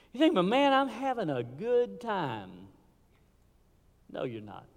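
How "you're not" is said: ordinary talk, nothing marked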